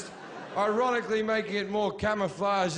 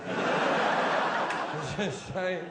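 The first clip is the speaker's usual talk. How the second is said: laughing repeat; much slower than usual. Real, not acted.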